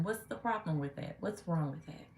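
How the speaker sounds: ordinary talk, nothing marked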